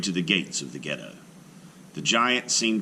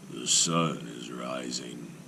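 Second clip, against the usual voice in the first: slow, low, soft giant in wonder; a simple giant marveling at dawn; 5 semitones lower, 5 dB quieter, slower than usual. Acted. Golem marvels at sunrise.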